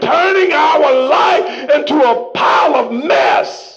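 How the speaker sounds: full-force preaching, slow and ringing. Acted. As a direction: drive the message home to the church. Preaching to the congregation.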